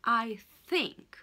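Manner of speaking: I think, tongue out between teeth on TH; careful th. Shown as a demonstration. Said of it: "Vous mettez la langue entre vos dents".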